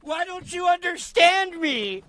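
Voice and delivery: high mocking whine. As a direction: whine it like a misunderstood teen. Mocking the clip's speaker.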